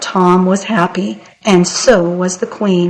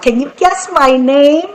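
high, eager little-man taunt; barely contained excitement; 6 semitones higher than usual. A narrator's reading. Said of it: "he could hardly contain himself".

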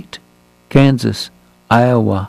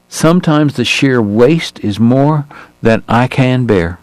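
slow, tired Southern gentleman's drawl; a weary Southern doctor, gently drawled; slower than usual. Acted. Doc laments the young men's deaths, slow Southern drawl. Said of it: "his accent even harder for a foreigner".

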